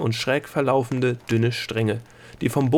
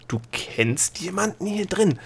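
higher, slower, amazed; astonished question; 9 semitones higher, 3 dB quieter, slower than usual. Acted. Gnome learns Delia knows someone inside, astonished. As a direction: ask in disbelief. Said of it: "fragte der Gnome erstaunt".

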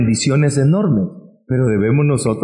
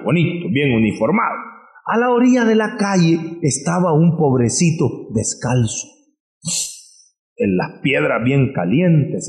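ordinary delivery

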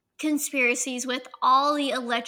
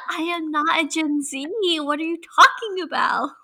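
laughing, louder denial; laughing protest; 3 semitones higher, 3 dB louder than usual. Real, not acted. Denies being Gen Z.

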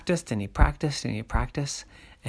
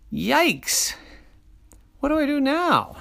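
alarmed; 12 semitones higher than usual. Acted. Boy panics at puppy.

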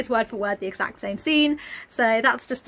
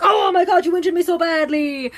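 loud, high mock wail; hammed-up shout, wildly overacting the injury; melodramatic outcry; 6 semitones higher, 5 dB louder than usual. Smacked person framing Santa, hammed-up outraged wail.

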